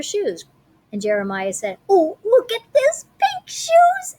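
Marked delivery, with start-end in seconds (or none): 1.9-4.2 high, slow, thrilled squeal; delighted squeal. Acted. Boy thrilled with his new pink shoes, high gush.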